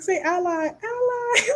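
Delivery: mocking high voice. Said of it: "he's like".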